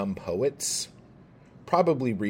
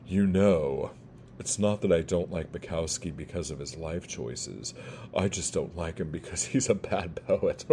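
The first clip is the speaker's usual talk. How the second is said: mock snooty critic; 5 semitones lower, 4 dB quieter than usual. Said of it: "some of you out there right now going".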